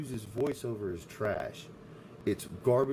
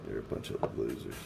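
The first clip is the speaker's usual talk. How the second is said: much lower, softer put-on voice; mock deep taunt; 13 semitones lower, 4 dB quieter than usual. Streamer taunting his four viewers, much lower mock voice. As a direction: drop way down to sneer it.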